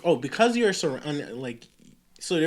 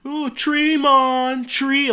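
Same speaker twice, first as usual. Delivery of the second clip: teacher mocking in a much higher mock Jamaican accent; hammed-up Jamaican "tree, mon", pitched up; 10 semitones higher, 5 dB louder, much slower than usual. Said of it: "Jamaican I mean".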